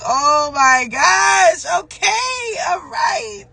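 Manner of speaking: slow, tearful high "oh my gosh"; overwhelmed exclamation. Retold or imitated. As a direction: relive being stunned by a sign. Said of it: "I'm just like".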